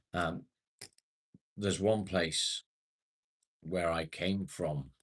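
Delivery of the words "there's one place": normal talking voice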